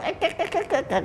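very high playful clip-clop horse calls; whinny like a toy horse for the child; horse noises. Retold or imitated. King playing horse for his son, high horse calls. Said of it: "ร้องม้าหน่อย".

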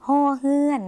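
ฮอเฮือน held high and level; letter-name pronunciation. Shown as a demonstration. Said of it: "คือเสียง". (ออกเสียง ฮอเฮือน สูงและลากเสียงเรียบ)

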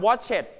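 "watched" said as "wotch-et"; two syllables, "watched" ending in a full "-et"; wrong extra syllable. Shown as a demonstration. Teacher tests the class with a mistake, "watched" as "wotch-et". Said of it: "ห้ามออกเสียงว่า watched เด็ดขาด".

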